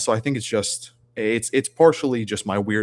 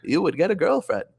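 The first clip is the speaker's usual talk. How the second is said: cheerful normie giving dating advice; 6 semitones higher than usual. Acted. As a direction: chipper nice guy lecturing incels. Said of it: "I have the normie voice, which is".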